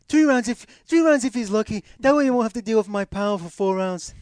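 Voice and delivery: loud, high mock Irish brogue; Irish accent. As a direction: boast in a hammed-up Irish voice. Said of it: "He says tree".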